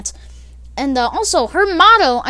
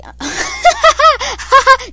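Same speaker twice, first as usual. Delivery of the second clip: a shrill, endless mock cackle, much higher; high weird cackle; 13 semitones higher, 8 dB louder than usual. Narrator mimicking a classmate's strange laugh: high cackle. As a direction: imitate her weird nonstop laugh, pitched way up.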